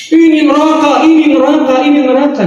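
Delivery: high-pitched repeated accusations; mock condemning preacher. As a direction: imitate a preacher damning everyone. Mimics preachers who condemn people. (meniru dai yang memvonis, nada tinggi)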